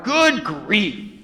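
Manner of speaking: high, punchy mock exclamation; Charlie Brown. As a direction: quote the catchphrase with comic dismay. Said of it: "to steal the words of Charlie Brown".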